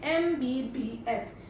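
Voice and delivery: "एमबीबीएस" said slowly, opening on a clear "ए" sound; sounded-out word. Shown as a demonstration. Teacher asks students to listen to "एमबीबीएस". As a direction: stretch "एमबीबीएस" so the vowel start is heard. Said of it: "ध्यान से sound को सुनिए".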